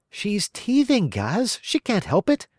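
calm her angry partner; pleading Maria. Acted. Maria soothing Gaz.

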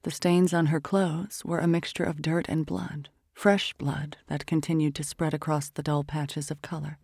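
ordinary delivery

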